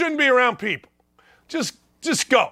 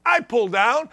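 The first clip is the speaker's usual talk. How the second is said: ordinary talk, nothing marked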